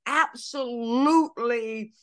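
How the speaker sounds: forceful sermon with stretched key words; impassioned preaching. Real, not acted. Preacher correcting a teaching on self-forgiveness, impassioned. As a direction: drive the point home like a preacher.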